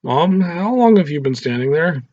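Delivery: dragged out in embarrassed shock. Acted. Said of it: "with shocked expressions on their faces".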